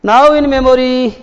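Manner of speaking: poem recitation. Shown as a demonstration. Teacher reading poem lines to students, very slow and lifted. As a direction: draw out "Now in memory". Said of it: "जरा ध्यान देना".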